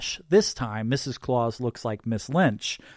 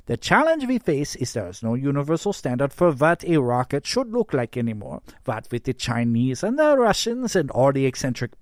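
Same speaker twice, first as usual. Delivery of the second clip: put-on emphatic German-accented presenter, often high and loud; thick German accent; 4 semitones higher, 4 dB louder than usual. A narrator's reading. Yule presents the rocket problem to Santa, thick German accent. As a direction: pitch it in heavy German English. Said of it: "Yule says in a thick German accent".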